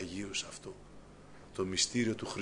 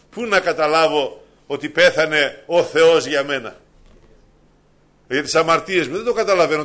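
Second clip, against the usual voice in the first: preacher marvelling at the mystery of faith; full-force preaching; 6 semitones higher, 11 dB louder than usual. Acted. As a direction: proclaim with wonder. Climactic sermon passage.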